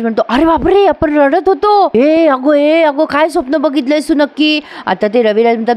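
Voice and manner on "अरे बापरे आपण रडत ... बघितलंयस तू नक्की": hammed-up shock. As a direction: overplay the shock for the brother. Brother hams shocked reaction.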